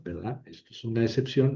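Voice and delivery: normal talking voice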